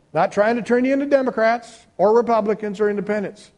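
ordinary talk

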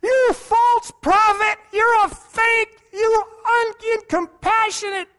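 heckling jeer. Retold or imitated. Hecklers shouting at Wigglesworth in a high mocking voice. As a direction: jeer like a hostile heckler in the crowd. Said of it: "hecklers in the crowd saying".

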